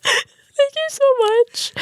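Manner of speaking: high, slow, gushing thanks; overly grateful. Retold or imitated. Driver thanking the cop for no ticket, high slow gush. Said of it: "And I'm like".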